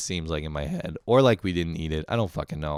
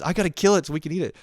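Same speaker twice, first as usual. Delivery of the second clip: rushed, higher panicked blurt; spazzing out; 9 semitones higher, 4 dB louder, much faster than usual. Retold or imitated. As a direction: blurt it out fast in a frantic spaz. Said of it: "I just spaz".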